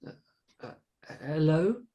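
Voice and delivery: halting, high-pitched hello rising into a question; nervous stutter. A narrator's reading. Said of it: "Carolina stuttered".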